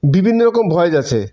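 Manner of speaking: usual speaking voice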